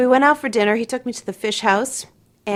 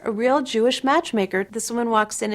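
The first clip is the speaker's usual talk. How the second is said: ordinary talk, nothing marked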